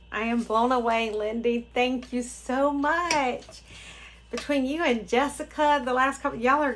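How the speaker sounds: thrilled and emphatic; blown away. Real, not acted. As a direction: pour out excited thanks. Thanks giver, blown away.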